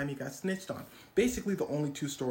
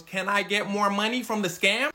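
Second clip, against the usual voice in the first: greedy partner; 3 semitones higher, 8 dB louder than usual. Retold or imitated. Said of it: "His partner goes".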